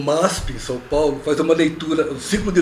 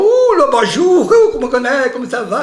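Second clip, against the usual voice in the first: mock French accent; 7 semitones higher, 4 dB louder than usual. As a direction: play a fussy Frenchman. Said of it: "coisinhas que lembravam o sotaque francês".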